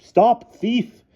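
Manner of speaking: high loud call; shouting. A narrator's reading. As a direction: yell after the thief. Headmistress chasing Bruno.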